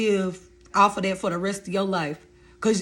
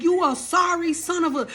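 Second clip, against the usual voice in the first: high, heated insult cut short; cussing out; 10 semitones higher than usual. Retold or imitated. Cursing the ex out.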